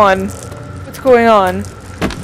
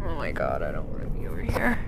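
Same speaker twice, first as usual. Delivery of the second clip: fast, hushed, frightened whisper; 16 dB quieter, faster than usual. Real, not acted. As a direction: whisper so the monster won't hear. Scared, hiding from monster.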